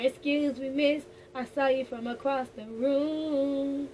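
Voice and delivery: smooth pickup line. Retold or imitated. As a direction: play it like a charmer crossing the room. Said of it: "This is more like".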